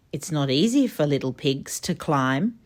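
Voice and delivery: ordinary delivery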